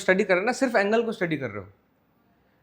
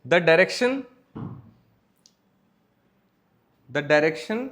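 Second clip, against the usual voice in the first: slow dictation with long pauses; dictado en clase; 4 semitones lower, much slower than usual. Acted. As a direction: dictate phrase by phrase for students writing it down. Dictates notes to students.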